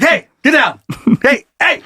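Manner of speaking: high calls to a dog; calling out. Retold or imitated. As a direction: call the dog off.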